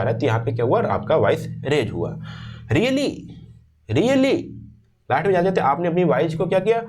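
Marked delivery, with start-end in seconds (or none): 2.7-4.4 much higher, surprised Really?; surprised rising tone. Shown as a demonstration. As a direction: sound amazed at the news. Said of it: "surprise दिखा पाओ".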